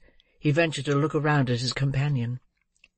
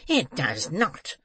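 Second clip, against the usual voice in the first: slow, contained fury; furious; slower than usual. A narrator's reading. Old man contradicting the girl, furious. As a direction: weigh each word with slow anger.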